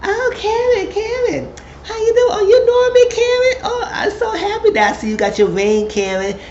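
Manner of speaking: very high, hammed-up gushing; mock a shady woman's squealed, overdone greeting; fake-sweet dig. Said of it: "Here go [name] with this dig".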